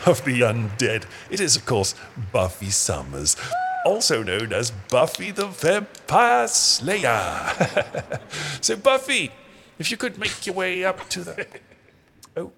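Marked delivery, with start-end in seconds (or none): 3.8-8.2 slow, drawn-out showman intro; grand announcement. Acted. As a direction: announce it like a ringmaster.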